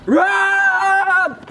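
loud, high warning; urgent shout. Acted. Acted alarm.

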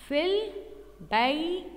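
في البي very slow, in two long separated pieces; prosodic sounding-out. Shown as a demonstration. Teacher showing prosodic writing, في البي drawn out slowly. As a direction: stretch في البي, pausing between the syllables. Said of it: "كيف بدنا نكتبها كتابة عروضية؟".

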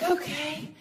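grin through the bad news; mock enthusiasm. Talking herself into aging, high fake cheer.

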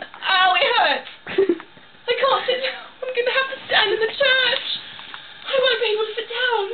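high, crying whine; high, near-sobbing complaint; tearful whine. Acted. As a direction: whimper like the day is ruined. Can't sit in church, distraught.

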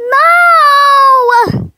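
high, loud cry of defeat; despairing cry. Acted. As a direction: cry out as you lose. Character defeated.

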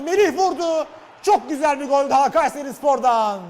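high, loud goal call; excited football commentator. Real, not acted. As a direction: shout the goal. (heyecanlı spiker)

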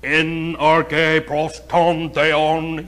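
very slow, pompous Greek recitation; mock Greek quoting. Preacher mocks pastors quoting Greek, slow and pompous. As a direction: drag out the Greek like a show-off scholar. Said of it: "they're quoting".

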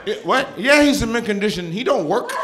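high mock-mom sales pitch; talking smack. Mom offers her son to a collector, high trash talk. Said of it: "she talks smack to me".

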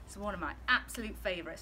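usual speaking voice

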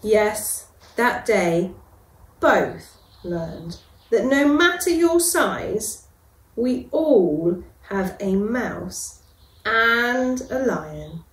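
ordinary delivery, nothing marked

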